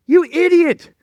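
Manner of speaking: high, loud berating of himself; self-bashing rant. Retold or imitated. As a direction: scold yourself hard for flying to the wrong town. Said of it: "And so I'm bashing myself".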